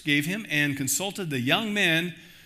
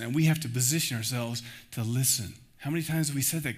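ordinary talk

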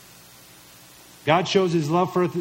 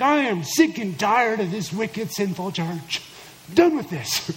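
mock fed-up; 3 semitones higher than usual. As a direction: play someone absurdly at the end of their patience. Imagined Jesus fed up with church.

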